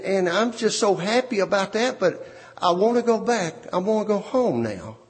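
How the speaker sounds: ordinary delivery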